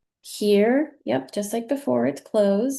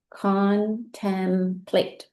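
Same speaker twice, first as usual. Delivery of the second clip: 把Contemplate拉长，一个音节一个音节慢慢读; slow blending; much slower than usual. Shown as a demonstration. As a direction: stretch Contemplate out slowly, sounding each syllable. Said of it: "Now let's read it".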